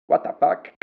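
put-on high little voice with a Thai accent. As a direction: imitate a first grader with a Thai accent, high and loud. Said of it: "In a little Thai accent".

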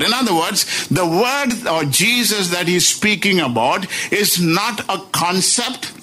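ordinary talk, nothing marked

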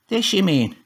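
high, scornful rough voice; mock the boy's softness; hard case scoffing at the lad; scoffing grandad Tommy. A narrator's reading. Tommy chuckles, holds shovel.

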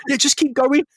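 very high, mock-reassuring voice; comic high-pitched reassurance; high-voiced Spider-Man. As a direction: wave them on in a squeaky pitch. Spider-Man reassures thugs from off screen.

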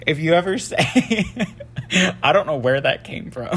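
cracking up mid-sentence; laughing. Real, not acted. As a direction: try to talk through a fit of giggles.

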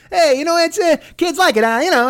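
high breezy salesman; Erwin Mainway impression. Toy maker explaining the bag of glass, high and breezy. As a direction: brush off the danger lightly. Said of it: "he still would explain".

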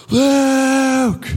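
imitated dad's long call; acted-out holler through the woods; distant calling. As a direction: stretch the name out like a far-off call. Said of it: "I heard my dad's voice".

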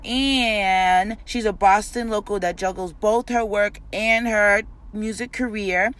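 loud, drawn-out hype-man announcer read; podcast host intro. Acted. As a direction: pump up the crowd like opening a show. Host hypes intro of show.

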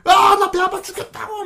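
very high, whining mock complaint; ranting patient. Patient too pained to think, acted high complaint. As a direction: wail the complaint as a suffering patient. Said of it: "막 욕을 막 한다거나".

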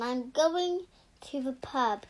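ordinary delivery

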